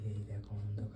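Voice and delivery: tense whispering in the dark. Real, not acted. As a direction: whisper urgently so he stays asleep. Sneaking into dark bedroom. (অন্ধকার ঘরে চাপা ফিসফিস)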